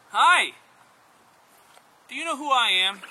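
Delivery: high, loud, bright-eyed hello; eager greeting. Acted. First man meets the creator of everything, eager and loud.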